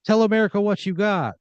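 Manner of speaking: hammy host calling to the nation; game show host. Acted. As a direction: play it up for the TV audience. Host addresses contestant.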